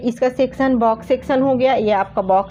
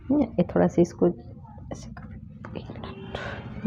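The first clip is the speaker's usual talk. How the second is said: whispered aside; 3 semitones lower, 10 dB quieter, much slower than usual. Real, not acted. Aside to a helper during demo.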